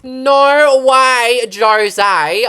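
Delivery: high, loud delighted exclaim; excited. Real, not acted. Builder whoops when archways place. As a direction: belt it out, thrilled.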